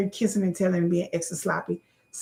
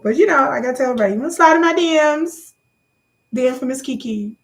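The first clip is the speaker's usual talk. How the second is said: fast, high and loud; excited; 5 semitones higher, 5 dB louder, faster than usual. Real, not acted. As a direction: rush it out excitedly. Excited plug after laughing.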